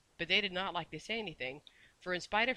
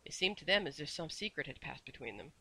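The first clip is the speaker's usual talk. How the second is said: ordinary delivery, nothing marked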